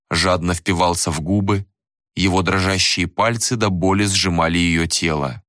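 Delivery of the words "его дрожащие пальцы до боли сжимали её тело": plain reading voice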